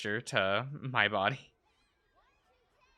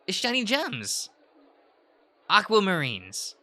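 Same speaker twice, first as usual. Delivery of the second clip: dreamy; 6 semitones higher, slower than usual. Acted. Dreamy high slow.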